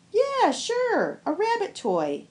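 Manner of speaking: high, offhand "yeah, sure"; a bored younger man shrugging it off; dismissive young man. Acted. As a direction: brush it off as just a toy.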